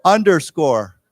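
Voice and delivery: "Underscore" said loud and clear for repetition; model pronunciation. Shown as a demonstration. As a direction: lead the class on "Underscore", falling at the end. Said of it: "let's say together".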